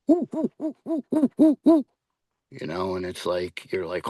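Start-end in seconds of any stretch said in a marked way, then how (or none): 0.1-1.9 high, hooting owl calls imitated; barred owl hoot. Investigator mimicking a barred owl's hoot, high hooting. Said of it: "It could goes".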